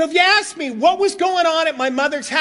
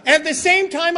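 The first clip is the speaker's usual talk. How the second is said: usual speaking voice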